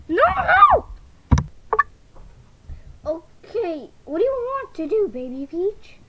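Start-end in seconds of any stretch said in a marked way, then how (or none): 0.1-0.9 high, loud cry of no, no; alarmed shout. Acted. Doll shrieking as the goop hits.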